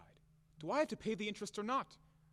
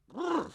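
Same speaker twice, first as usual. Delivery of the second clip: high, louder brr of disgust; angry shudder; 12 semitones higher, 4 dB louder than usual. Acted. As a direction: shake it off with a growl. Shivering with rage.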